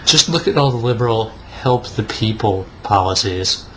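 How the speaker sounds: usual speaking voice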